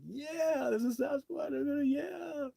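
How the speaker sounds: very high, tearful squeaky joy; overjoyed crying. Retold or imitated. Researcher reliving his encounter, high tearful joy. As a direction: cry it out in happy disbelief. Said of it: "I'm like".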